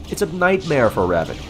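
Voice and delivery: ordinary talk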